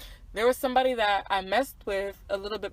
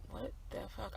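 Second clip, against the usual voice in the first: breathy, much softer stunned whisper; whispered disbelief; 14 dB quieter than usual. Retold or imitated. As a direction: mouth it in shock. Stunned reaction to his outburst.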